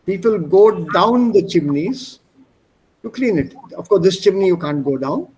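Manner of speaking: normal talking voice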